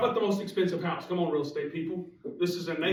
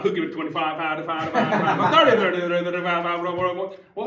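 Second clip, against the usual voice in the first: rapid auction chant, louder; auctioneer; 4 dB louder than usual. Shown as a demonstration. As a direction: rattle off bids like an auctioneer. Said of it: "We could do it auctioneer style".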